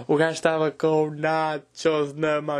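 drawn out at half speed; slow emphatic repeat. Retold or imitated. Comedian repeating the absurd detail to the audience, slow and emphatic. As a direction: stretch out each word for emphasis.

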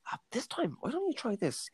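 high, soft coaxing suggestion; tactful acting teacher. Acting teacher giving criticism to a student, softly tactful. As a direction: imitate a director gently easing in a note. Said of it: "I just love how they give criticism".

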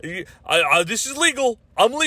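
high whiny mock voice of a self-righteous rights guy; ridiculing an entitled camera guy insisting on his rights, high mock whine; mocking entitled filmer. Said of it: "these fuckers go like".

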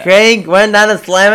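usual speaking voice

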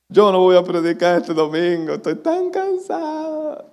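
whiny, high-pitched exhausted complaint, drawn out at the end; mock near-crying. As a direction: imitate yourself almost in tears, voice climbing high. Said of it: "casi llorando".

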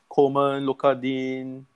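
ordinary talk

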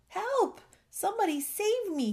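very high-pitched cry for help; shouting for help. A narrator's reading. Stranded bullfrog in a flood, shouting for help. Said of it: "he shouted".

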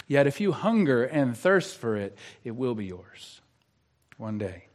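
hushed, solemn promise from the pulpit; soft pastoral assurance. Real, not acted. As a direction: voice sinking low and very quiet.

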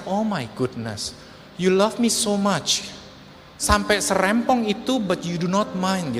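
slow, heartfelt amazement; touched wonder. Retold or imitated. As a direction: draw the words out, moved by mother's love. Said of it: "saya cuma bisa ngerasain". (terharu, pelan dan tulus)

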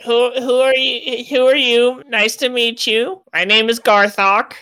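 high-pitched, hesitant goblin greeting; startled goblin. Acted. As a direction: stammer hello as a nervous little goblin.

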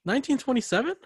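disbelief. Real, not acted. Disbelief at actor's birth year.

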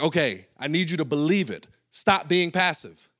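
half-laughing coach insisting; coach pushing. Retold or imitated.

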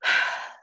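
big re-enacted sigh; retold sigh, all breath; huge sigh. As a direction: let out one heavy breath. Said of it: "had this huge sigh".

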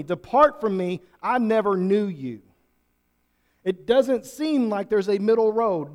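loud, driving sermon voice; preaching at full force. Acted. Pastor urging the congregation, full force preaching. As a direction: preach it out to the back row.

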